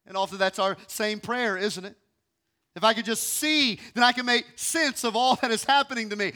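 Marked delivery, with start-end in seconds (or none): none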